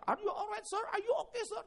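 high, bright-voiced caring stranger in English; solicitous high voice asking a fallen man if he's okay; kind foreign helper. Foreign cleaner helps fallen speaker.

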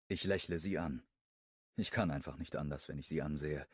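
ordinary delivery, nothing marked

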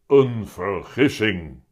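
loud, slow cat pronouncement; heavy-voiced talking cat. A narrator's reading. The cat repeats it to puzzled Willem, slow heavy voice. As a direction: repeat it slowly in the big cat voice. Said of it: "herhaalt Dikke Teun".

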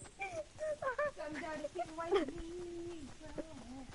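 laughter held back behind closed lips; stifled giggles. Real, not acted. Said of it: "I'm trying not to laugh".

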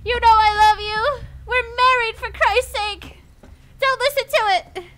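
high, loud playful indignation; mock outraged protest. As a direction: plead loudly like a wronged spouse. Mock quarrel with eight ball.

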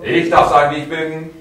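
loud and forceful; powerful. Shown as a demonstration. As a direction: hammer it out. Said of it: "Nochmal, kräftig".

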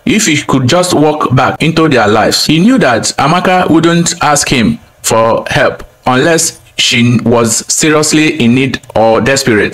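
usual speaking voice

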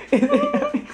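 very high sing-song yummy. Real, not acted. Asking child in baby voice.

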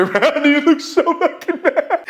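talking through real laughter; high, squeaky words squeezed out while cracking up; can barely tell it for laughing. Real, not acted. Cracks up recalling the angry cop.

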